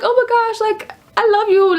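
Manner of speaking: high-pitched mock fangirling; imitated gushing. Vlogger imitating a passer-by, high excited gushing. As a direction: gush it like an excited friend.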